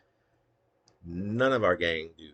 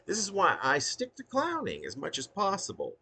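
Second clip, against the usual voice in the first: high clowning line; 12 semitones higher than usual. Shown as a demonstration. Clown explaining his choice, high and playful. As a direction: lift it up and lean on clowning.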